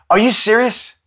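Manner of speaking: normal talking voice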